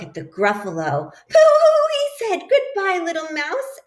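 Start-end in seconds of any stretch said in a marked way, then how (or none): 1.3-2.4 very high, loud startled cry; mock fright hoot. Owl sees the Gruffalo, yelping in hammed-up fright. 2.5-3.8 high, hurried fearful farewell; nervous goodbye. Acted. Snake flees the Gruffalo with a high nervous goodbye. Owl fleeing.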